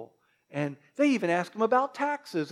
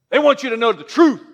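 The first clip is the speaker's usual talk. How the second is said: mock stern preaching; 3 semitones higher, 8 dB louder, faster than usual. Mocking truth-only churches.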